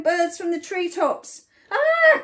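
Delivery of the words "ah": loud, high shriek of alarm; piercing storybook cry; high scream. A narrator's reading. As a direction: scream like panicked animals. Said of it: "cried the animals".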